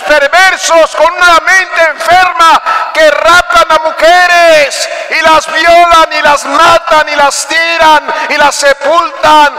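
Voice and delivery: high, loud, slow fiery sermon; preaching at full force. Acted. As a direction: thunder it at the congregation. Preacher denouncing sin, shouting.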